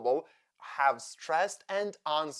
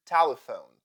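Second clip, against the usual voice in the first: TEL-e-phone, punched at the start of 'telephone'; first-syllable stress; 3 semitones lower, 7 dB louder than usual. Shown as a demonstration. Teacher illustrating rule two, first-syllable stress on 'telephone'. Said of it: "stress the first syllable".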